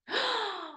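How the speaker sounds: a sharp, high gasp of sudden realisation; surprised gasp. Retold or imitated. Mother realising her daughter remembered the trick, gasping. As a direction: catch your breath in surprise.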